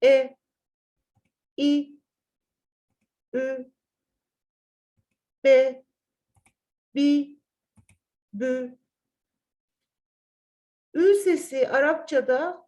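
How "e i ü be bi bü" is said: "E, i, ü, be, bi, bü" kept short, not stretched; short vowels. Shown as a demonstration. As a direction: clip each vowel, no lengthening. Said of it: "Bunu kesinlikle yapmıyoruz". ("E, i, ü" uzatmadan kısa okunuyor)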